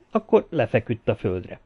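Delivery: usual speaking voice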